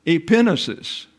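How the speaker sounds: Epinosis said eh-PIN-uh-sis, PIN stressed; stress on second syllable. Shown as a demonstration. Teacher showing Greek pronunciation, Epinosis stressed on PIN. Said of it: "why the accent on the second syllable".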